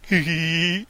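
thin, drawn-out "hee hee"; giggle. Real, not acted. Enjoying a joke with the chat, drawn-out giggle. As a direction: let out a long hee-hee.

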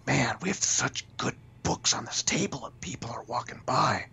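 whispered inner thought. Retold or imitated. Inner thought about passing buyers.

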